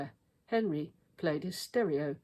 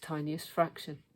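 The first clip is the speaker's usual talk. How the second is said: plain reading voice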